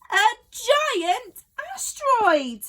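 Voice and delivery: high, slow, dramatic cry; alarmed exclamation. A narrator's reading. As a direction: narrator reveals the asteroid, alarmed. Dramatic reveal.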